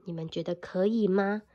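ordinary delivery

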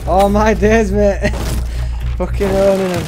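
loud, high, gleeful shouting; excited shouting. Real, not acted. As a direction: shout it out in triumph. Winning fight, shouting at screen.

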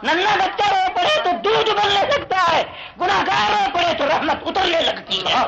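high, ringing sermon cry; fervent, high-pitched pulpit delivery; impassioned preaching. Real, not acted. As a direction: preach it out high with long held notes. Preacher cries out the point at full pitch.

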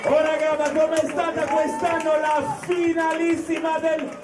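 shouting to the crowd; hype shout. Real, not acted. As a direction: call it out to hype the room. Host hyping the crowd.

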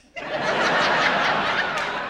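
loud high breathy laugh; real laughter. Real, not acted. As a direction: crack up laughing. Laughs after punchline.

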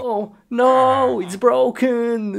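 high, loud wail of dismay. Acted. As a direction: cry out as if it is ruined. Acted dismay at broken thing.

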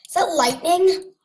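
high, loud startled question; alarmed. Real, not acted. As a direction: yelp it in alarm. Sudden alarm at lightning.

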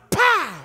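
explosive shouted pow; a sudden crack of pads; sharp imitated impact; loud pop sound. Hit heard in the backfield.

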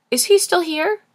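put-on high, loud young boy asking; child's question. Grandson questioning grandmother about the renter, high child's voice.

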